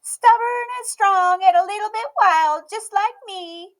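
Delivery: put-on high, bright little girl voice, loud and proud; a stubborn little girl declaring herself; Emmy's child voice. A narrator's reading. Child voice of Emmy.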